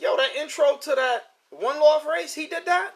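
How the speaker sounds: excited high-pitched disbelief; imitated amazement. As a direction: play an astonished fan asking about it. Voicing a surprised viewer.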